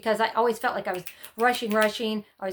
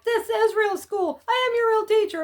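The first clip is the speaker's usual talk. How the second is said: high mock indignation; 12 semitones higher, 4 dB louder than usual. Mom to daughter, insisting in a high mock voice. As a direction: insist in a high, put-on teacher voice. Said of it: "And I'm like".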